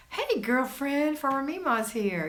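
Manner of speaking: high, slow, sing-song hello to a friend; excited greeting. Real, not acted. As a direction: call out a delighted, drawn-out welcome. Excited greeting to arriving viewer.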